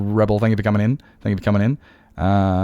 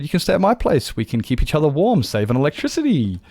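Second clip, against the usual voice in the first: higher-pitched quoted offer; joking offer; 7 semitones higher than usual. Retold or imitated. Streamer retelling his joke offer to a driver, high acted voice.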